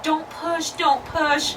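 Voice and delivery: high, pleading don't push; husband pleading with wife in labor; panicked husband. Retold or imitated. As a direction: beg her to hold on.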